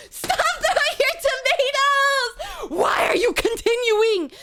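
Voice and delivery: half-laughing shriek of protest; mock outraged shout. Yelling at chat to stop throwing tomatoes, mock outraged.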